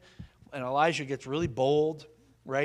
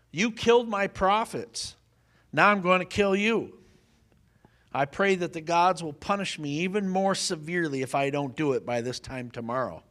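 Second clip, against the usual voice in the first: angry threat; 4 semitones higher, 7 dB louder than usual. Retold or imitated. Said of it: "She's mad".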